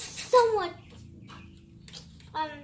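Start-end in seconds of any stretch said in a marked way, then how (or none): none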